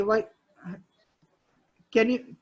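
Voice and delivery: ordinary talk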